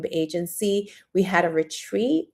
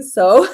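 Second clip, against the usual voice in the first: loud high laugh breaking out; real laugh, voice jumping up; burst of laughter; 13 semitones higher, 9 dB louder than usual. Real, not acted. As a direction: crack up for real. Laughing at the mix-up.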